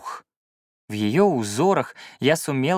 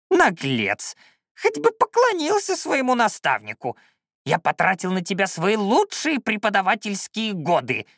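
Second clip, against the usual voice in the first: high, creaky tirade; outraged old shaman; 9 semitones higher than usual. Acted. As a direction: berate an ungrateful pupil at high pitch. Old shaman berates his pupil.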